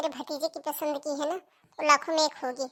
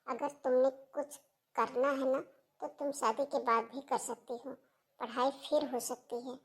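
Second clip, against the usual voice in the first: soft, lower-pitched reassurance; gentle persuasion; 3 semitones lower, 6 dB quieter than usual. Acted. Sister-in-law coaxing Seema to accept marriage, gentle. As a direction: reason with her quietly and tenderly.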